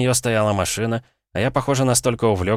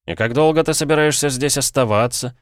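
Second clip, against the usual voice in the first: higher, syrupy sweet question; sickly-sweet tenderness; 8 semitones higher, 4 dB louder than usual. A narrator's reading. Host sweetly asking an unwanted guest, cloyingly affectionate. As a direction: lay on the fake sweetness. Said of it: "спросил я ласково, придав голосу столько патоки".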